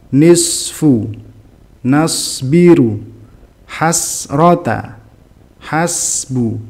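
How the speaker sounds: slow syllables, middle fricative held a full beat; flowing consonants. Shown as a demonstration. Teacher drills examples for students, sustaining the middle consonants. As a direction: let each hissed consonant stream into the next syllable. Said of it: "Karena dia mengalir".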